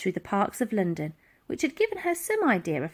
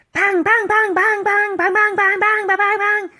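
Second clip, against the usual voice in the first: mock gunfire; 12 semitones higher, 8 dB louder than usual. Gunfire sound effect.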